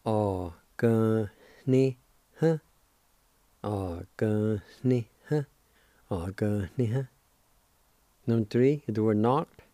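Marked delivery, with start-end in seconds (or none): 0.0-7.0 Oh, gun, ni, huh split slowly; syllables of ganiha. Shown as a demonstration. Teacher sounding out Oh, gun, ni, huh slowly. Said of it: "It's pronounced like this".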